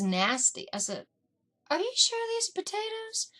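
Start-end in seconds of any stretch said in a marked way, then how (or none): 1.7-3.3 high, doubting question; a higher, doubtful question; suspicious. Retold or imitated. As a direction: ask it, already suspecting.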